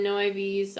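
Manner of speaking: normal talking voice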